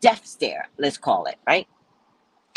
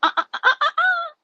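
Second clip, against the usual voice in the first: very high-pitched string of "uh"s; squeaky mock stutter; mimicked stammer; 4 dB louder, faster than usual. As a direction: imitate a taken-aback reaction in high staccato. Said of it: "kinda like saying".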